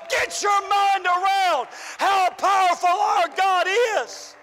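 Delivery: ordinary delivery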